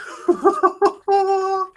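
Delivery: high wailing laugh, half crying; overwhelmed laugh. Real, not acted. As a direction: laugh helplessly, close to tears. Overwhelmed reaction to the trailer.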